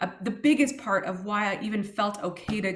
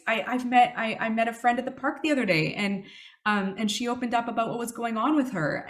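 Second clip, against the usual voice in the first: normal talking voice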